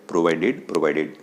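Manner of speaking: usual speaking voice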